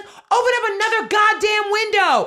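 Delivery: furious customer yelling at the post office clerks; Karen mode. Retold or imitated. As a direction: scream it like an entitled Karen in line. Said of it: "loses her shit".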